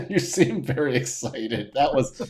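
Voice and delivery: ordinary delivery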